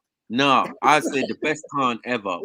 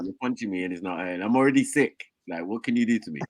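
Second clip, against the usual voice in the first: lower, softer crusty sick voice; 5 semitones lower, 7 dB quieter than usual. Retold or imitated. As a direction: mumble it low like you're ill. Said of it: "the Ponytail voice, all crusty".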